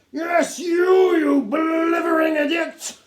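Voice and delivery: high, loud, slow roar of contempt; enraged schoolmaster; furious shout. A narrator's reading. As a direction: scream the insult at the boy. Captain shouting insult.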